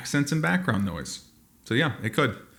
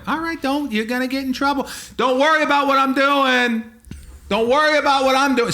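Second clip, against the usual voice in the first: loud, high-pitched indignant yelling; hammed-up angry shouting; mock heated quarrel; 12 semitones higher, 4 dB louder than usual. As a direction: act out a hothead telling someone off. Acted quarrel with onlookers.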